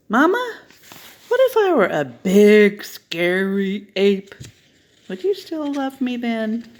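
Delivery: put-on high, pleading little child asking mama; a small kid begging for an answer; excited child's voice tugging at mama. Child voice in story.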